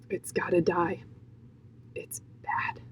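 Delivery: very soft, whispered verdict; hushed and solemn; grave whisper. Real, not acted. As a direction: drop to a whisper for a grave aside. Grave aside to viewers.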